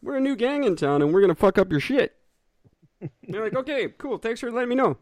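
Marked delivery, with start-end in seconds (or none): 0.0-2.1 high, swaggering mock tough guy; mock gang announcement. Voicing new gang announcing itself. 3.5-5.0 high, mock-polite brush-off; sarcastic reply. Public's sarcastic reply to gang.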